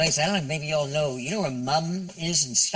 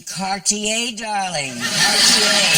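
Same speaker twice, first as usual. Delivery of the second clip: ordinary talk, nothing marked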